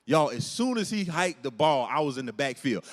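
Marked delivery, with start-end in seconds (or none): none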